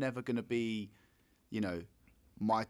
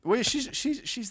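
put-on light American accent slipping in; a hint of American accent; 11 semitones higher, 5 dB louder than usual. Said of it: "Have I got an American accent now?".

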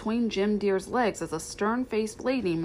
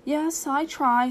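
slow, higher, tearful reply; 6 semitones higher, 3 dB louder, slower than usual. A narrator's reading. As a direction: plead slowly, close to tears. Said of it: "said Lady with tears in her dark eyes".

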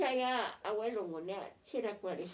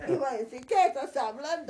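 laughing; 7 semitones higher, 11 dB louder than usual. Retold or imitated. Laughing retelling of a remark.

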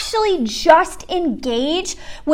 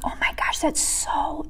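breathless, whispered realization; awed whisper; 10 dB quieter than usual. Real, not acted. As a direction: hush it as the truth lands. Awed reaction to Jesus' words.

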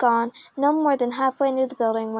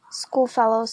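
usual speaking voice

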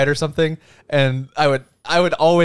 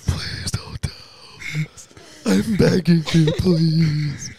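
pleading whisper; 2 semitones higher, 3 dB quieter, slower than usual. Retold or imitated. Begging sibling not to tell.

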